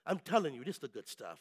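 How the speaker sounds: low, soft Jamaican hustler; rusty Jamaican accent. Acted. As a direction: assure them quietly in a put-on Jamaican accent. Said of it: "a little rusty on my Jamaican ask, uh, uh, accent".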